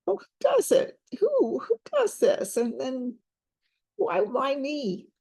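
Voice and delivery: very high, strained, wailing questions; imitating a desperate client. Counselor acting out the client's desperate demand, high wailing. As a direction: rise up into a desperate wail. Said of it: "She's like".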